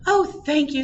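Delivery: high, loud burst of thanks; grateful. A narrator's reading. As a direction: gush your thanks as the bride. Grateful bride thanks her helper.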